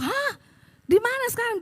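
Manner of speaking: very high startled questioning; acted-out alarmed question; shocked reaction. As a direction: react aghast to sudden bad news. Shocked reply to stroke news.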